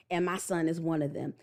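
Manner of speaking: usual speaking voice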